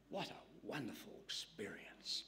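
awed whisper. Real, not acted. Awed aside on access to God.

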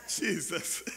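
ordinary talk

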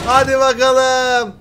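loud gleeful taunt; excited. Real, not acted. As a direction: drag it out with glee. Riders head toward lava.

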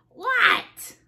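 very high-pitched "What?"; yelled reply. Acted. Froggy answers Mom's call, high yelled reply. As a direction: squeal it back like a called child.

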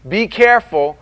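usual speaking voice

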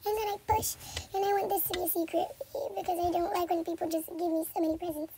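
usual speaking voice

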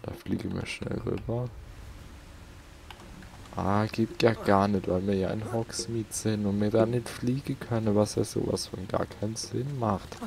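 usual speaking voice

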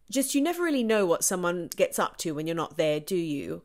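not-quite-RP London British accent. Shown as a demonstration. Actor showing her warm-up line for a role, British London accent. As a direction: put on a London-ish British accent, sound placed forward. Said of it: "British accent that was not quite R P, but it was kind of London".

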